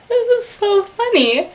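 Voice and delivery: high, breathless laugh-talk; laughing squeal. Real, not acted. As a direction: gasp it out through giggles. Cracking up at the book.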